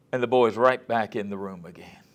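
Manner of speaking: ordinary talk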